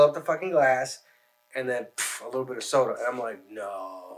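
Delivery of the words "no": low, soft, half-hearted protest; retold feeble refusal. Drinker seeing the overfilled glass, feeble mock refusal. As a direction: let it drop low and quiet.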